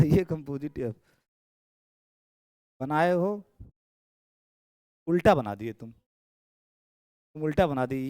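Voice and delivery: normal talking voice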